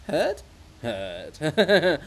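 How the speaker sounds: sing-song mocking reply. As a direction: toss it back with a sneer.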